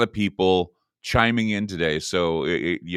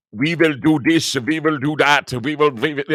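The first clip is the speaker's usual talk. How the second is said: mock German accent; 6 semitones higher than usual. Host mimics Klaus Schwab's pronouncements, mock German accent. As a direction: lay on a heavy German accent. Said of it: "And he's like".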